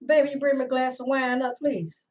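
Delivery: quick, hushed ask trailing off; asking husband downstairs to bring up wine, softly; soft phone request. Real, not acted. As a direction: murmur a sweet favor fast into the phone.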